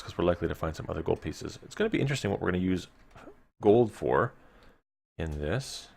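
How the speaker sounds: normal talking voice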